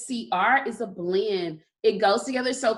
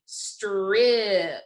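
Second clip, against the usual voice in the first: "strip" stretched out slowly; slow sounding out. Shown as a demonstration. Teacher asking herself what sounds she hears, word drawn out. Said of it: "say it slowly again".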